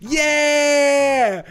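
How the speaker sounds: high, loud whoop of joy; excited shout. Acted. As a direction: let out a big cheer. Hyped shout while trying accent.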